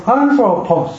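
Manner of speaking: anthropos with the accent on AN; stress on first syllable. Shown as a demonstration. Teacher showing students first-syllable accent, stressed AN in anthropos. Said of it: "它是第一个".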